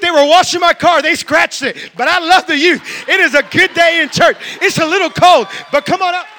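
loud, high, exuberant preaching; grateful enthusiasm. Acted. Grateful churchgoer loving rain and scratched car, exuberant shouting. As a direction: build to booming joyful gusto. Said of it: "somebody that has a grateful heart".

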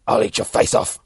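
rough, loud, voiceless snarl; harsh growled threat; threatening animal. Acted. Wolverine threatens Deadpool.

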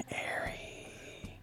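drawn-out whispered "Airy"; hushed, voiceless "Airy". Shown as a demonstration. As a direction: whisper "Airy" very softly, all air. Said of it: "I'm not airy enough".

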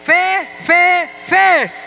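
calling the word out high and loud; loud repeat. Real, not acted. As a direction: belt it out to the group. Repite en voz alta el mensaje. (repitiendo fuerte y agudo)

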